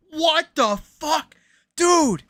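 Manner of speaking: high, drawn-out disbelief; stunned high what-the-fuck; shocked. Real, not acted. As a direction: stretch it out in shock. Shocked by the menu item.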